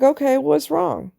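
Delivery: wife asking. Retold or imitated. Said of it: "And she's like".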